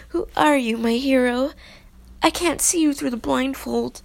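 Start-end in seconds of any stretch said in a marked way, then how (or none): none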